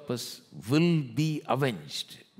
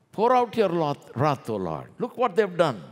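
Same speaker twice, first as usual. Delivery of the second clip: loud, high cry to God; preacher imitating wronged people crying out; outcry in prayer; 8 semitones higher, 7 dB louder than usual. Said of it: "That's what they're saying".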